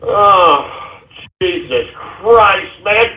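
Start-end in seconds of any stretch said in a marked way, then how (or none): none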